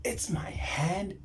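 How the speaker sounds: slow repeated whisper, rising at the end. Retold or imitated. Repeating to himself what touched his forehead, slow whisper. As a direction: breathe it out again in disbelief.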